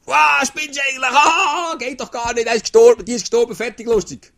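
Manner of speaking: high, loud scornful yelling; mocking shout. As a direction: shout them down in disbelief. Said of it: "aus dem Brüllen sagen".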